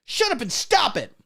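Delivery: high shrieking mock yell; mock scream. Mocking Hollywood groomers, shrieking a high mock yell. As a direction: screech it like a frantic scold. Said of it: "scream at your penis and go".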